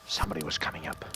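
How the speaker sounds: a tense, unvoiced whisper; hushed whisper as footsteps draw near. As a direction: breathe the words so nobody hears. Narrator hears elevator rising.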